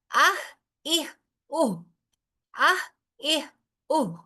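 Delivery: آہ اہ اوہ breathed out after a glottal catch; ha with hamza demo. Shown as a demonstration. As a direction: catch then breathe the vowel out. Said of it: "اس کو ادا کرنا ہے ہا کو". (ہمزہ کے بعد ہا کی ادائیگی)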